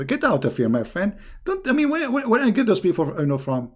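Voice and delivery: ordinary talk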